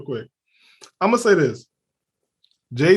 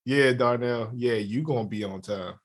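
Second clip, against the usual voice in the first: low, much softer, dry sarcastic "yeah, you gonna be on time"; deadpan disbelief, low and quiet; 8 semitones lower, 8 dB quieter than usual. Shown as a demonstration. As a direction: mutter it so nobody believes it. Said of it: "That's sarcasm".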